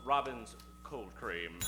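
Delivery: ordinary delivery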